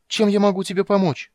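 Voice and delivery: high, loud anxious question; worried. Acted. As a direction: ask anxiously, wringing hands. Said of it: "с беспокойством спросил Тассельхоф". (с беспокойством)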